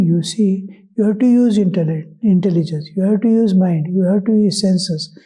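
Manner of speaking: ordinary delivery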